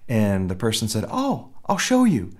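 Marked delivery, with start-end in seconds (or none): 1.1-2.3 much higher, bright offer to help; eager helpful stranger. Retold or imitated. Gas station attendant eagerly offers to lead the way, much higher. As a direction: chirp it like a keen helper.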